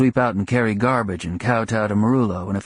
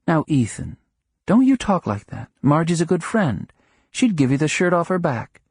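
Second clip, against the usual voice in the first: a higher, gently scolding wife; lightly voiced wife; 7 semitones higher than usual. A narrator's reading. Wife scolding Ethan for teasing about Margie, lightly raised voice. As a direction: chide him, defend her friend.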